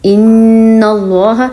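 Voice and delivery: ان اللہ drawn out, nun held long through the nose; slow nasal ghunna. Shown as a demonstration. Teacher slowly demonstrating ghunna for students to copy. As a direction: stretch the ن in ان اللہ, tongue on the palate. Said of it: "آپ میرے ساتھ پڑھ کے دیکھیں".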